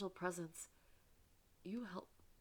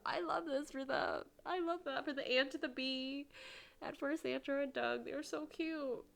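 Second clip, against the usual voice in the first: higher, fond gushing over the couple; 8 semitones higher than usual. Real, not acted. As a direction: sing their praises, lifted and fond.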